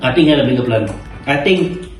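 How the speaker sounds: ordinary talk, nothing marked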